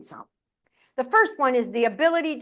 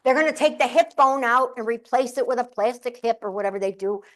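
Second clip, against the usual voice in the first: ordinary talk, nothing marked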